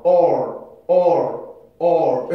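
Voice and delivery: or said loudly, lips closing slightly into the R; R-colored vowel demo. Shown as a demonstration. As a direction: glide or from an open vowel into R. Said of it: "R 발음을 해주면서 조금 입을 다물어요".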